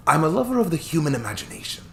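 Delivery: put-on chuckling old attorney, light Scottish touch; cheerful old Scottish lawyer. Hammond wistful.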